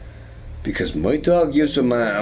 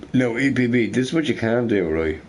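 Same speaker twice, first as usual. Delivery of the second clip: ordinary talk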